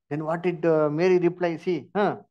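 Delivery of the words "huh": ordinary talk, nothing marked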